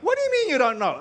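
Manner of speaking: high-pitched mock disbelief; incredulous challenge. Congregant challenging the preacher who forgot his sermon, incredulous. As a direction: throw the question back, imitating an exasperated questioner.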